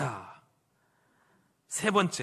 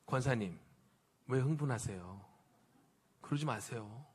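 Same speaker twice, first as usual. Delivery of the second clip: low, soft voice of a soothing pet; calm talking dog; 8 semitones lower, 10 dB quieter than usual. Acted. Dog addressing its agitated church-lady owner, calm and hushed. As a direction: gently talk an agitated owner down, quietly. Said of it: "강아지가 여러분에게".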